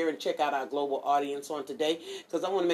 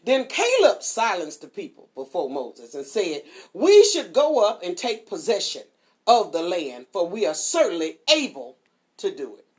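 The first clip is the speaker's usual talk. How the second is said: louder, measured Bible reading; scripture reading; 6 dB louder than usual. Acted. Preacher reading Numbers to listeners, proclaiming loudly. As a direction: proclaim the passage to the flock.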